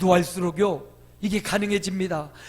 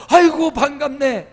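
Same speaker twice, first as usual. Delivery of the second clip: much higher and louder delighted welcome; higher, louder, overjoyed "good to see you"; warm hearty greeting; 7 semitones higher, 9 dB louder than usual. Shown as a demonstration. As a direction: greet them like you've missed them. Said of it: "이렇게 맞아주시기 바랍니다".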